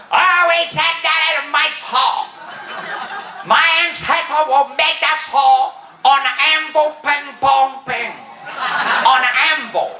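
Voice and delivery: put-on high-pitched caricatured Japanese accent; mock Japanese businessman. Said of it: "Little Japanese bloke, he goes".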